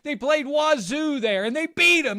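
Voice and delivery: high, loud, breathless-with-news mock fan; gleeful fan. Imitating Hoosiers fans after a Rose Bowl win, excited.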